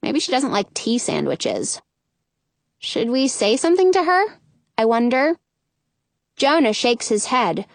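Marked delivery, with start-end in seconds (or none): none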